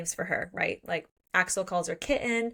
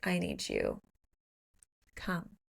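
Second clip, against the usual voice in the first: come-hither; 7 dB quieter than usual. Retold or imitated. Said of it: "gives him a come hither".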